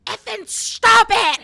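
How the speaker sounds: high loud slow angry shout; angry yell. Retold or imitated. Brother yelling at his sister, loud angry shout. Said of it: "He said".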